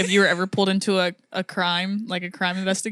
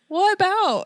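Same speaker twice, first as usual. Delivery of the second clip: much higher-pitched mimicked question; imitating curious friend; 12 semitones higher, 3 dB louder, slower than usual. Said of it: "And she was like".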